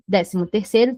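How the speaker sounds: ordinary talk, nothing marked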